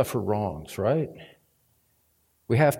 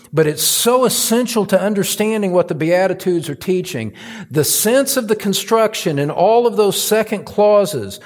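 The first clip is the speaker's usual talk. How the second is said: high, loud pulpit preaching; fired-up sermon delivery; full-force preaching; 7 semitones higher, 5 dB louder than usual. Acted. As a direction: preach it out to the congregation.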